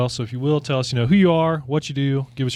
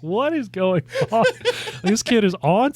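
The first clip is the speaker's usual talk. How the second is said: high, drawn-out disbelief; slow, high-pitched bewilderment, close to laughing; amused bafflement; 10 semitones higher than usual. Retold or imitated. Father retelling parents watching their stuck son, baffled.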